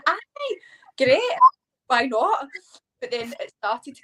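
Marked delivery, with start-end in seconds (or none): 0.1-2.5 cheery agreement. Retold or imitated. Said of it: "And I'm going".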